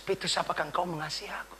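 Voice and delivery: soft, gentle asking of Peter; gentle question. Acted. The Lord gently asking Peter, softly. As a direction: ask him softly, lovingly. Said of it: "Seperti Tuhan bicara kepada Petrus".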